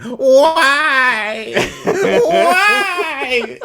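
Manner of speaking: loud, very high mock wail; over-the-top wailing cry; hammed-up anguished wail. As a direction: imitate a dying man wailing, drawn out. Imitates impaled torturer crying out.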